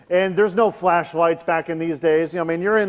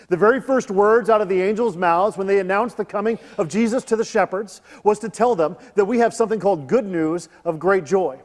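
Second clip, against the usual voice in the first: usual speaking voice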